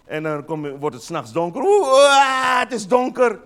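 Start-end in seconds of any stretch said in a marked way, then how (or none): 1.6-3.4 mock fright. People scared of the dark.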